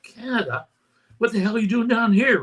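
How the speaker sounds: imitating a Texas storekeeper's amazement; incredulous local. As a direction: act out the shocked small-town local. Gas station guy retold, disbelief.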